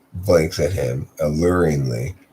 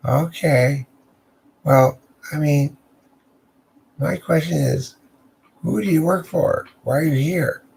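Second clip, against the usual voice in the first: much higher teasing woman's voice; 9 semitones higher than usual. Acted. The lady at the door teases Fabian in a high voice.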